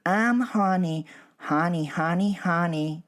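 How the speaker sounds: high, loud sing-song birdcall; sweet bird song. Acted. Songbird calling at dawn, high sing-song. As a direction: trill it like a songbird.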